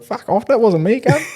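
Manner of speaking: high-pitched, put-on indignation; mock indignant protest. Friend voices a kid blamed for something, mock indignant.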